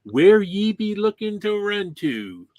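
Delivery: high, slow hammed-up pirate accent. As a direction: ham up a pirate voice, drawn out and high. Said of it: "the head alien guy says to them".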